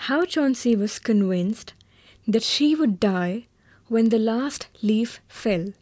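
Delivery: usual speaking voice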